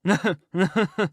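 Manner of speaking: a short, rasping laugh, half amused; raspy chuckle. Acted. The Duke laughing at how the servants fooled everyone, raspy chuckle. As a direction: chuckle like a sly old rogue. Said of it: "burst into a kind of little raspy chuckle".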